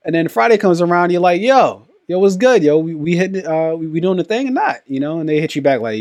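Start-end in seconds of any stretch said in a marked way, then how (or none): 1.4-4.8 loud, high, pumped-up yo yo; hyped friend. Retold or imitated. Friend calling to confirm Friday, pumped and loud. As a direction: shout it like you're ready to party.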